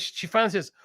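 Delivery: usual speaking voice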